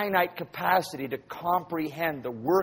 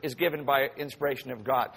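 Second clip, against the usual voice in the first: ordinary talk, nothing marked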